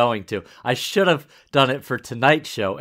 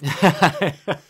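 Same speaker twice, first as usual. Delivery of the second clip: amused burst of chuckles; laugh; 4 semitones higher, 4 dB louder than usual. Real, not acted. Mother tickled by the typecasting, laughing. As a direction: laugh out loud in a quick run.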